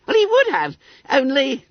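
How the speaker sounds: high, squeaky schoolboy voice; self-satisfied Billy Bunter. A narrator's reading. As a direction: pipe it up high, full of importance. Bunter makes his excuse.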